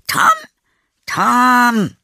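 loud, very high, drawn-out call. Acted. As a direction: holler the name across a distance. Aunt calling again in the garden. (laut und langgezogen rufend)

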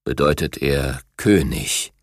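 deep, slow, weighty tiger king voice; gentle giant. A narrator's reading. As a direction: let it sink low, full of dignity. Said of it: "sprach König Eisfell mit tiefer Stimme".

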